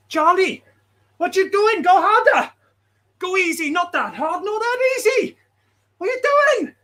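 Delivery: high, loud, shouty coach voice; squeaky hammed-up coach yelling at Charlie; Ed mode. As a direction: bark orders like an excitable coach. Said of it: "I can do Ed mode".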